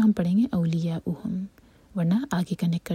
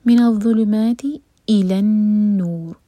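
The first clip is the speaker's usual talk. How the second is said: louder chanted recitation of the verse; full recitation; 3 semitones higher, 8 dB louder, much slower than usual. Shown as a demonstration. Teacher recites the phrase aloud for students. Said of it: "ما مد طبعی دو حرکہ لمبا".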